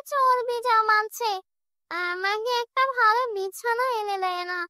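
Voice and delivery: possessed mother grumbling about her son and the bed; grumbling witch. Acted. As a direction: nag about the bed like a spoilt spirit. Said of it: "এসব বকবক করতে করতে".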